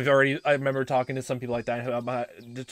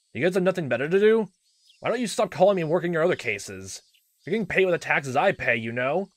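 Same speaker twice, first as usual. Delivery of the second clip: raised, annoyed voice; irritated rebuff; 6 semitones higher, 6 dB louder than usual. Acted. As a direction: complain sharply into the phone. Irritated uncle rebuffing the caller.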